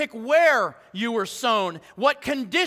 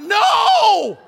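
a loud, much higher panicked cry; mom freaking out; 11 semitones higher, 10 dB louder than usual. Pretend. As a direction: scream it like a mom seeing the cradle fall. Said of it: "any mom's, like, freaking out".

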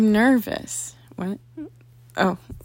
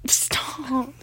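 whispering; 3 semitones higher, 6 dB quieter than usual. Real, not acted. Whispered protest.

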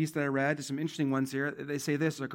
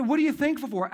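What are you thankful for? asked much higher and louder; pressing the church again, higher and louder; raised repeat; 10 semitones higher, 5 dB louder, faster than usual. Shown as a demonstration. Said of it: "Let me ask you, church".

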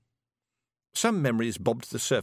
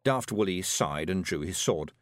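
usual speaking voice

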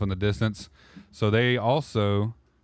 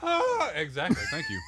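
excited high yelp. Real, not acted. Reacting to a moan imitation.

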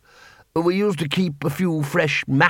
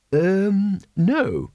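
lightly raised, bumbling wizard voice; flustered Ridcully; 6 semitones higher than usual. A narrator's reading. The Arch-Chancellor to the patrician, a lightly voiced bumbling wizard. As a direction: stammer like a confused old wizard.

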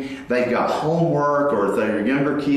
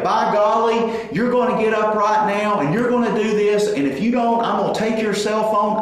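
higher, mock stern threat from a dad; dropping the hammer; 7 semitones higher than usual. Dad threatens daughter over her messy room, mock stern. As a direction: lay down the law, half-acted. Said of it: "I can drop the hammer".

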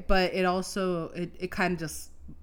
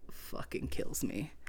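whispered; 3 semitones lower, 9 dB quieter, slower than usual. Real, not acted. Confessing frustration, voice drops.

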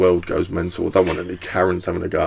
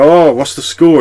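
bright, raised mock curiosity; higher, louder feigned interest; fake interest; 7 semitones higher, 8 dB louder than usual. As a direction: pretend you care about cricket.

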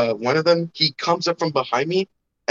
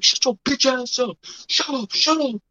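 much higher bratty taunt; squeaky kid mouthing off at him; mock kid yelling shut up; 9 semitones higher than usual. Kids talking smack, imitated.

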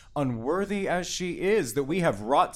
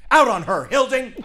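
loud, higher, spat-out insult; furious outburst; 5 semitones higher, 5 dB louder than usual. Acted. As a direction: fling the insult at her. Father curses daughter before wife.

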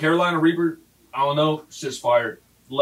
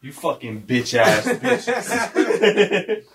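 drawn-out laughing insult; 6 semitones higher, much slower than usual. Real, not acted. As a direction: laugh through slow teasing.

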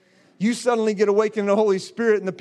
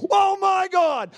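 high, drawn-out excited cry; big high exclamation of astonishment; awestruck shout; 7 semitones higher, 3 dB louder, slower than usual. Retold or imitated. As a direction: shout it slowly in amazement. Exclaims over the dream's climax.

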